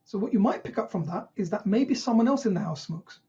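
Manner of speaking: usual speaking voice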